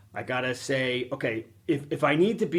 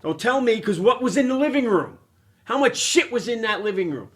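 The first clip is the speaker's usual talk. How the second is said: raised, louder and higher, insisting; heated yelling; 8 semitones higher, 6 dB louder than usual. Real, not acted. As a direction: push back loud, defending yourself. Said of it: "it looks like I'm yelling at the camera".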